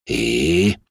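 ordinary delivery, nothing marked

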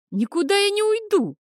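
very high, loud defiant cry; anguished shout. A narrator's reading. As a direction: scream the refusal. Boy refuses, shouting.

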